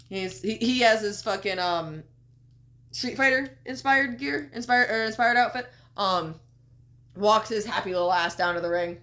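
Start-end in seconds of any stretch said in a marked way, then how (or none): none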